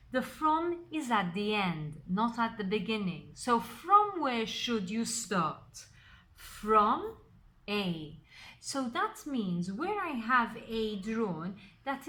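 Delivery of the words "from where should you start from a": both "from"s leaned on hard; slow question, then "from" set apart by pauses; stress on from. Shown as a demonstration. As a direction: hammer home "from" like a teacher. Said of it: "I'm putting emphasis on the word from".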